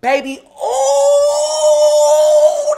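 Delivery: long, high, loud wail; drawn-out high shout; outraged cry. Acted. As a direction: stretch it into a furious howl. Outraged outburst after guest leaves.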